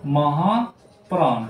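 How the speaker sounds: महाप्राण drawn out slowly, syllable by syllable; slow spelled-out. Shown as a demonstration. Teacher repeats महाप्राण slowly for the children. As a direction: stretch महाप्राण out slowly so pupils hear each part. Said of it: "दूसरा होता है महाप्राण".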